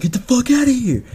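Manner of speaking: high, urgent mock yell; acted-out warning shout, pitch jumping up; retold alarm yell. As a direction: imitate a buddy yelling to scatter. Friend's alarm when spotlights hit them.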